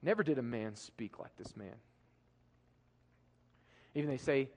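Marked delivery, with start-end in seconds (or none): 0.0-1.7 hushed repeat. Real, not acted. Repeated line, dropped to hush.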